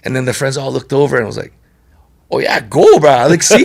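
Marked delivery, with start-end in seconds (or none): 2.3-3.6 excited egging on. Retold or imitated. Friends egging on, loud.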